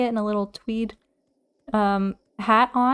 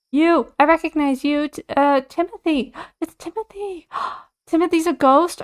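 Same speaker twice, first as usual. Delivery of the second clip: high, loud gasping exclamations; rising excited cries with gasps; excited outburst; 7 semitones higher, 5 dB louder than usual. Real, not acted. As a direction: blurt it out, thrilled and shocked. Recognizing the ghost villager.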